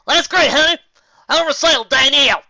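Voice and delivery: high, loud reply; shouting. Acted. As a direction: shout back over gunfire. Shouting back in battle.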